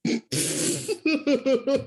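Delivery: high burst of laughter; mocking laugh. Real, not acted. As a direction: laugh at the squeaky voice. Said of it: "What the hell was that?".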